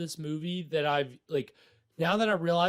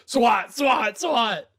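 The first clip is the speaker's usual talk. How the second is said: mock raid call; 5 semitones higher, 8 dB louder, much slower than usual.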